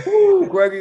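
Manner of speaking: a gleeful tease at a friend's loss; excited ooh. Real, not acted. Teasing Gregory about the ball's worth, excited and loud. Realizing value of the ball.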